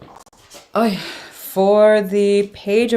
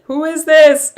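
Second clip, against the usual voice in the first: loud high startled cry; shocked shout; 11 semitones higher, 10 dB louder, slower than usual. Real, not acted. Tarot reader yelping at a shocking card. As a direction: blurt out in total disbelief.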